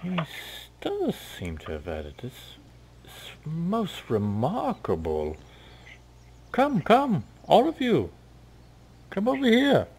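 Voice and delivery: sly elf rising high on come, come; dark elf beckoning. Acted. Dark elf waves the pair over, rising high.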